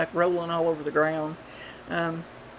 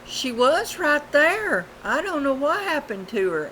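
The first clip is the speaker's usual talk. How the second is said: sister answering; 8 semitones higher, 3 dB louder than usual. Retold or imitated. The sister answering from the bank, bewildered. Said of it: "her sister said".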